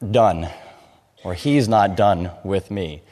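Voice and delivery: normal talking voice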